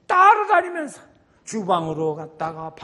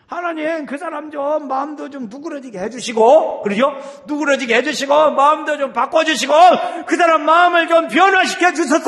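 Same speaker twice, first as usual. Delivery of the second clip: high, loud pleading, swelling to a shout; pleading prayer; 10 semitones higher, 13 dB louder than usual. Shown as a demonstration. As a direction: beg God to change that person's heart. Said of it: "이렇게 하나님한테 막 그래요". (간절히 매달리는 기도)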